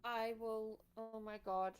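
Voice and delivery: ordinary talk, nothing marked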